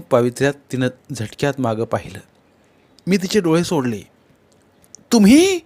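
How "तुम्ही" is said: surprised. A narrator's reading. She turns and sees him.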